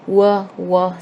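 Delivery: "W, w" sounded as "wuh, wuh"; isolated W sound. Shown as a demonstration. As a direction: say "W, w" as the sound, not the letter name. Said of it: "w sound is repeated".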